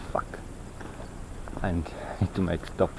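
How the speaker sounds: normal talking voice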